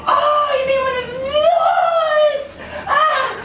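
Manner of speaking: high, slow, crying-laughing; laughing squeal. Retold or imitated. He realizes what she means, high laughing wail. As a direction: stretch it out through the laugh. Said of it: "I'm like".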